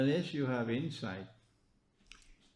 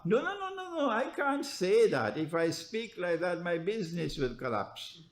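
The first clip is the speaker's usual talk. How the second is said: mock timid scholar; 4 semitones higher than usual. Mocks timid scholars' excuse.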